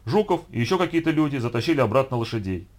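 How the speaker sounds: plain reading voice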